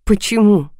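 amused, scornful questioning; wry smirk. A narrator's reading. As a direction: toss it back with a smirk. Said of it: "усмехнулась я".

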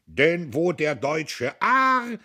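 loud, slow, swelling declaration; heated proclamation. A narrator's reading. Diederich proclaiming to Buck, loud and slow. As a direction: drag out each word like a battle cry. Heated political shouting match.

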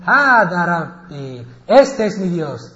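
loud, slow declaration; proclaiming prophet. Acted. Ibrahim hails the full moon, proclaiming slow and loud. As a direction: declare it slowly and loudly, drawing out words. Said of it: "y dijo".

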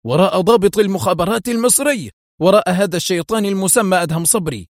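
high-pitched, exasperated cry; acted furious exclamation; angry shout. A narrator's reading. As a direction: yell back in anger, pitch up. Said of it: "صاح حايم بغضب".

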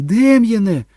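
very high, loud scream at her son; full scream. A narrator's reading. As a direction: scream his name in panic. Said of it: "закричала Кетрін". (відчайдушний крик)

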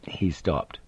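ordinary delivery

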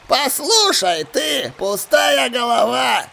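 high, drawn-out pirate cry; jeering old pirate's slow outburst; scornful exclamation. Acted. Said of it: "воскликнул он".

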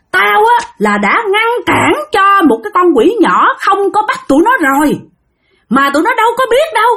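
put-on high-pitched, indignant spirit ranting through a boy; acted possessed tirade, pitched well up; possessed hollering. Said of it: "Nhắc lại y chang như là lời Khải nói".

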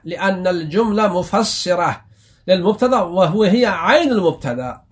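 usual speaking voice

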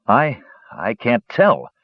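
slow, faltering, unsure; halting and uncertain; hesitant. Acted. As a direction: stammer it out, lost. Lost in the storm.

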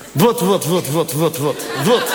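short show of his stage Moroccan accent on wat. Shown as a demonstration. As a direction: wat said as a clipped Moroccan-Dutch wot, again and again. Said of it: "Marokkaans accent op een podium".

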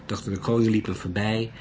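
usual speaking voice

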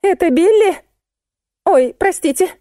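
very high little phone voice; thin squeak. A narrator's reading. Musenka phones a wrong number, squeaking. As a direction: ask and apologise in a squeak. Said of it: "тоненько запищала Мусенька".

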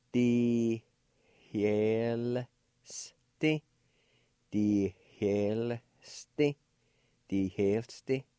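"die hellste" said with a faint breathy h before hellste; careful pronunciation demo. Shown as a demonstration. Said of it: "It'll sound something like this".

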